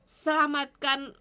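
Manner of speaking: earnest pleading prayer to God; fervent prayer. Acted. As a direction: pray aloud for the city, pleading. Continues prayer, quieter. (doa yang sungguh-sungguh memohon)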